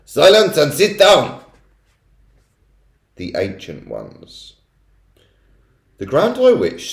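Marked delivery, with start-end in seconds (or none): 0.0-1.4 loud, higher, slow command; commanding shout. A narrator's reading. Witch silencing the audience, loud and slow. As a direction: bark the order.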